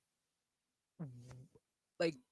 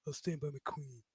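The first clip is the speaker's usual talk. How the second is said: softer mopey mumble; mopey Jon Snow; 7 dB quieter than usual. Retold or imitated. Streamer mocking Collins to chat, softer mopey mumble. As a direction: mope it out like a sulking hero. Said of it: "he's just moping around going".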